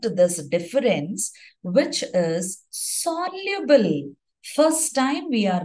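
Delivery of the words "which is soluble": "soluble" drawn out slowly on a high pitch; emphasis on soluble. Real, not acted. Teacher pointing out a key difference to students, stress on "soluble". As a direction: stretch "soluble" high to make it stand out.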